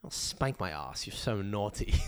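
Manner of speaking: low, slow, soft British come-on; mock British dirty talk, dropped low. Host ridiculing British dirty talk, low mock British accent. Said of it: "who the fuck talks like that".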